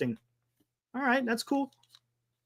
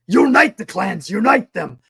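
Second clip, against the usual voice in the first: Scottish rallying cry; 3 semitones higher, 5 dB louder than usual. Retold or imitated. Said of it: "That's what it kinda reminds me of".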